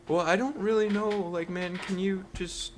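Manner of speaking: mock the timid stranger pleading for money; mumbling awkward guy. Imitating a nervous beggar, high and meek. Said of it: "He's like".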